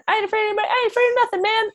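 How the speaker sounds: put-on high, puffed-up tough-kid voice; boyish bravado. A podcaster voices Skinny bragging to his friends in a high tough-kid voice. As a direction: play the scrawny kid acting tough.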